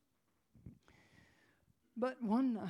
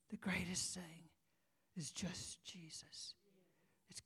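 whisper; 3 semitones lower, 8 dB quieter, slower than usual. Real, not acted. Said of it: "whispered climax of sermon".

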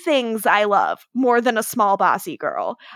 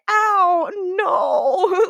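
mock pain; 7 semitones higher than usual. Cole hurt, thrown at wall.

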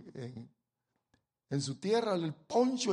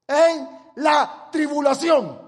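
slow, loud, drawn-out proclamation; full-force preaching; 7 semitones higher, 12 dB louder, much slower than usual. Acted. Preacher driving home the theme to the congregation, slow loud shout. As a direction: hammer the theme home from the pulpit.

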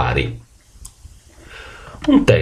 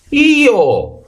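donkey shout. A narrator's reading. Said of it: "gridò l'asino". (grido acuto d'asino)

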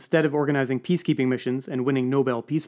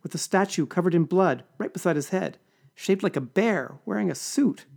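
sobbing; 6 semitones higher than usual. Acted. Assistant recounts finding the body, mild sobbing. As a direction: sob through the memory. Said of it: "She started to sob".

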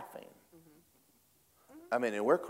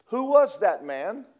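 put-on child's voice; 11 semitones higher, 8 dB louder, slower than usual. Child's question in retold story.

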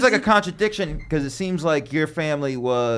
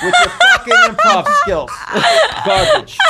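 high-pitched laughing tease; 5 dB louder, slower than usual. Real, not acted. Laughing at friends' improv skills, teasing. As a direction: cackle the insult at them.

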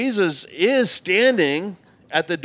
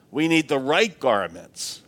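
ordinary talk, nothing marked

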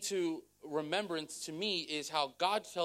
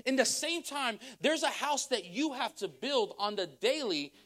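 higher, driving sermon delivery; rising preaching; 6 semitones higher than usual. Acted. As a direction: lift the congregation, preach it out. Building into full preaching.

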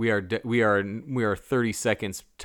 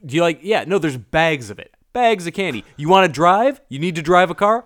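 higher, louder desperate offers; frantic bribing; 7 semitones higher, 7 dB louder than usual. Retold or imitated. Kerwin voiced bribing Billy, higher and louder. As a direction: bargain fast and urgent, a little panicked. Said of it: "Just like".

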